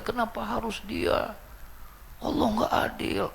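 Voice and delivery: high hammed-up lament; overdone grief. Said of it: "lebay banget saya bilang nih orang".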